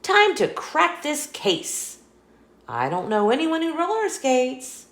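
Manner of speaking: a lightly voiced cat agent on the case; keen cat detective. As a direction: get down to business, detective. Meow starts investigating.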